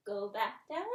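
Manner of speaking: ordinary delivery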